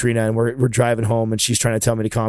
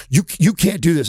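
higher, stammered mock objection; retold indignant stammer, pitched up; flustered protest, imitated; 6 semitones higher than usual. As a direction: imitate staff sputtering in protest. Retells flustered tow yard staff.

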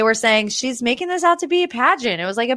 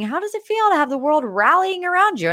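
high quoted question; 8 semitones higher than usual. Retold or imitated. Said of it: "by asking".

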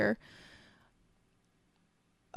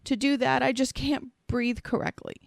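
higher, louder distressed reading of an apology; retold tearful apology; 6 semitones higher, 5 dB louder than usual. Reading his last words as he's crying, retold tearful apology. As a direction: voice his desperate apology without full sobbing. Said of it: "he's crying now".

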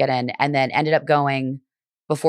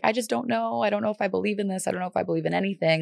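higher, softer voice of doubt; confessing her doubt to the priest while crying, higher and softer; retold doubt; 5 semitones higher, 5 dB quieter than usual. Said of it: "And I was like".

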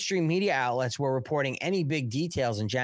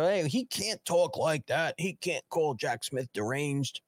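mock whiny objection.

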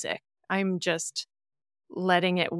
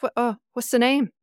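curious Irish worker, lightly imitated; a hint of an Irish lilt, higher; Irish accent; 5 semitones higher, 4 dB louder, faster than usual. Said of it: "Well done on the accent".

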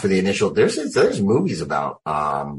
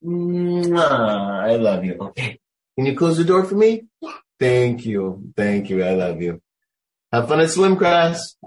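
sweet talk to daughter; 8 semitones higher than usual. Real, not acted. Father to daughter interrupting the interview, high cooing voice.

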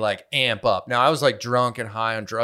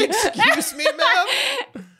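mock offended laugh; much slower than usual.

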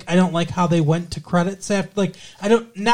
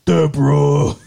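a loud try at Ray calling Deborah; lightly voiced sitcom husband; imitating Ray; 2 semitones lower, 5 dB louder, slower than usual. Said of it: "now you sound like Ray".

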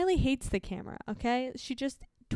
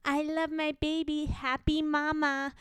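Instagram mama; 6 semitones higher than usual. Acted. Mocking gushy mom posts about her baby, Instagram-mama voice. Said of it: "how I feel about mama on Instagram".

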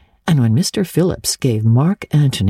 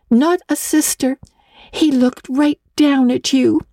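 put-on higher, playful young girl voice; teasing girl Diana; 10 semitones higher than usual. A narrator's reading. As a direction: tease your friend about the boy.

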